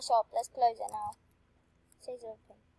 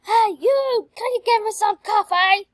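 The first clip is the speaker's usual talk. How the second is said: loud and much higher, calling out bossily; rude customer demand; 9 semitones higher, 10 dB louder than usual. Retold or imitated. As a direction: bark it at the cashier like a pushy customer.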